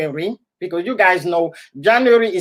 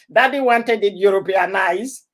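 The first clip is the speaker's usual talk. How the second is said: ordinary talk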